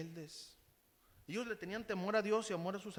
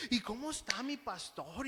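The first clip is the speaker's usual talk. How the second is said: flattering; 4 semitones higher than usual. Retold or imitated. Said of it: "nomás ahí lisonjero".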